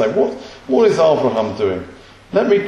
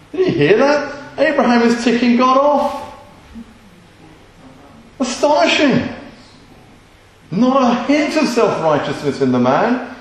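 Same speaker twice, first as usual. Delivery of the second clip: preacher thrilled by Abraham's boldness, pitched way up; amazed; 8 semitones higher than usual. Real, not acted.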